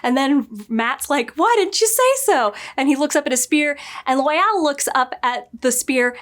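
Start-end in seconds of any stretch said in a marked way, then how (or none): 1.3-2.5 high, hammed-up why didn't you; mock incredulous. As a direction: toss it off in a squeaky, cocky voice. Mat answers with the dagger.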